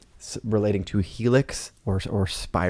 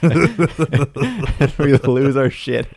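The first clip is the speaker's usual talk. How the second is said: laughing; 5 semitones higher, 4 dB louder, much slower than usual. Real, not acted. Laughing while retelling.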